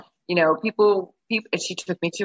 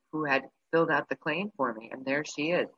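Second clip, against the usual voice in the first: ordinary delivery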